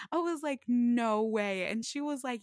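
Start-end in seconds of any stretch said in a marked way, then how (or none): 0.7-1.6 stunned no way. Retold or imitated. Reacting to free meal news.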